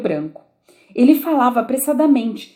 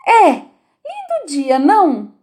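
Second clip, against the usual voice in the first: high, very slow timid rabbit; 7 semitones higher, 5 dB louder, much slower than usual. A narrator's reading. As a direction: venture it shyly and slowly. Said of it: "falou uma voz tímida". (voz tímida do coelho, aguda e lenta)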